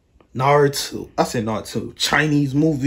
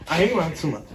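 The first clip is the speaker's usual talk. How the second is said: ordinary delivery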